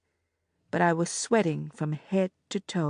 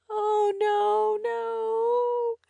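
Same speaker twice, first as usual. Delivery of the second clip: much higher, fading moan; much slower than usual. A narrator's reading. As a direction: let the despair slip out. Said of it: "I moaned".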